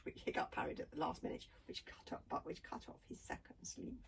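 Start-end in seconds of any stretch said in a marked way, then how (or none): none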